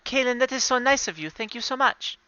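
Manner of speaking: lightly put-on polite voice, pitched high; grateful character. In-character thanks for gifted sandwich.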